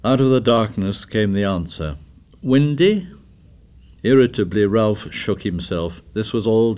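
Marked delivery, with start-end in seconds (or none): 2.4-3.1 higher, louder sneering jab; mocking taunt. A narrator's reading. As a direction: needle him with mockery. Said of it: "Ralph heard the mockery".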